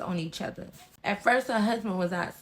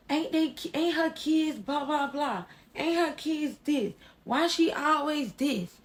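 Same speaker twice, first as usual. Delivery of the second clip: higher, drawn-out mock nagging; mimicked complaining; 5 semitones higher than usual. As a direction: parrot their complaints in a slow singsong. Mimicking neighbor girls' chorus of complaints.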